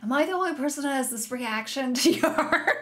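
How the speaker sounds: asking through a laugh; laughing question. Real, not acted. Knitter asking viewers if others react so, laughing. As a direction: let the laugh break through. Laughs at her own excitement.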